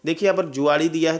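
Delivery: ordinary delivery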